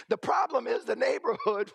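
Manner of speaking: ordinary talk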